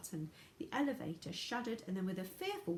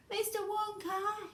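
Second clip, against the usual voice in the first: high, slow, carrying call; yelling over noise; 13 semitones higher, 3 dB louder, slower than usual. A narrator's reading. Charlie yells to Wonka above the noise, high. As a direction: call out to be heard over a roar. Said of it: "he yelled above the noise".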